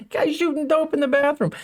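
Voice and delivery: high-pitched, laughing as you speak. Real, not acted. Commentator laughing at a bizarre tweet photo, laughing voice. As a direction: squeeze the words out through a laugh.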